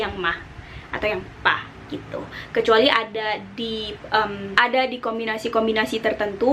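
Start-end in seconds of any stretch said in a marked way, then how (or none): 0.2-1.6 ma and pa low and short; short low third tone. Shown as a demonstration. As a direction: keep ma and pa low, clipped. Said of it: "yang pendek aja sih". (nada ketiga pendek dan rendah)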